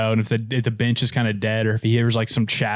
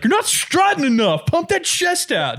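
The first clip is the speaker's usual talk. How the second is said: mock coach. Joking coach telling the batter to strut, hammed-up high bark. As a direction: play a silly strutting coach. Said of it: "I was just going with it where they're just like".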